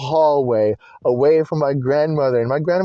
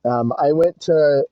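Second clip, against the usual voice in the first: ordinary talk, nothing marked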